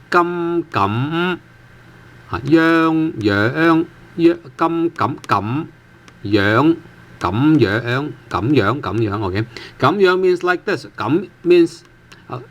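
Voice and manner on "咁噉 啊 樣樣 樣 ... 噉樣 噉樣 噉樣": words drawn out syllable by syllable; slowed sounding-out. Shown as a demonstration. Teacher sounding out two new words for learners, slowly drawn out. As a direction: stretch each Cantonese syllable for learners.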